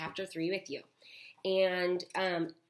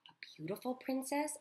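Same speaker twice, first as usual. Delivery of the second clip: put-on a bit higher, softer girl's voice; Abeline asking; 8 semitones higher, 5 dB quieter than usual. A narrator's reading. Abeline asking grandmother about the princess, softer. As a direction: ask it eagerly like a child.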